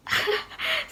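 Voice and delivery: brief high-pitched amused laugh; a little burst of laughter; short laugh. Real, not acted. Telling viewers about arriving in London, short laugh.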